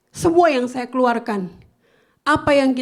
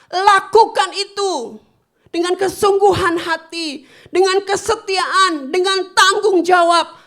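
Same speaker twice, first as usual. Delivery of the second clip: slow, high, loud exhortation; impassioned pastor call; loud preaching exhortation; 8 semitones higher, 6 dB louder than usual. Acted. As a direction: urge them on like a rallying cry. Preaching to congregation.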